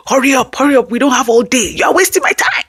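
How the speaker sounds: high-pitched, rushed mock nagging; hammed-up impatient parent hurrying a kid. Shown as a demonstration. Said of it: "So instead of".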